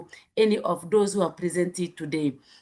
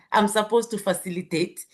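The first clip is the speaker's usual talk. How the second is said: ordinary talk, nothing marked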